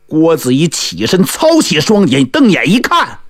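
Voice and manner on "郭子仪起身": ordinary delivery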